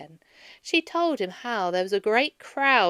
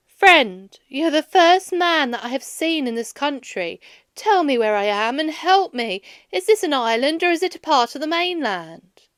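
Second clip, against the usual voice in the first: eager plea; 5 semitones higher, 9 dB louder than usual. A narrator's reading. Odysseus pleads with the shepherd.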